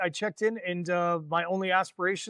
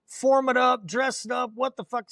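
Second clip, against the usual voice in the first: barking orders; 6 semitones higher than usual. Retold or imitated. Young Marine chewing out loitering boots, mock shout. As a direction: yell it like a fresh corporal at the troops. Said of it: "I was like".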